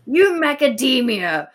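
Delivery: loud, high mock insult. Podcaster inventing nut insults, loud and high. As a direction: toss it out as a silly jab.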